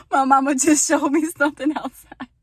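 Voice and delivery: high loud laughter through the words; laughing. Real, not acted. To viewers, laughing at mama high and loud. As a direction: apologize while cracking up.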